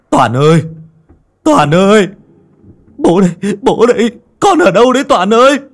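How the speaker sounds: high, loud cries rising in panic; frantic screaming. Acted. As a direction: shout desperately for a lost child. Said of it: "Lão Bạt đứng dậy gào thét".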